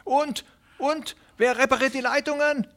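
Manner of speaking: high, hurried pleading voice of a small worried farmer; anxious little tenant. Acted. Hooven blurts out a reason. (aufgeregter kleiner Pächter, hohe, drängende Stimme)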